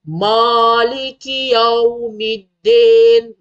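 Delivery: loud, stretched "Maliki" as "Moliki", "Yaumiddin" ending "-den"; mistaken recitation. Shown as a demonstration. (baca salah "Maliki Yaumiddin", bunyi "mo" dan "den")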